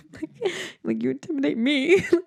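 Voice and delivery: laughing mock fear. Teasing him during the game.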